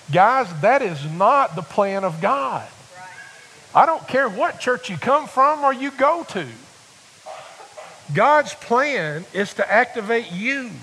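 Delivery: full-force preaching. Acted. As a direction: rouse the congregation with fire. Preacher builds to climax.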